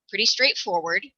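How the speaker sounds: ordinary talk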